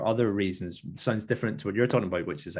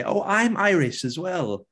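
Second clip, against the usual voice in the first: higher, drawn-out mock enthusiasm; eager claim; 10 semitones higher, 4 dB louder, slower than usual. Americans telling Irish visitors they share roots, mock eager. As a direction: imitate someone proudly chiming in, stretched and high.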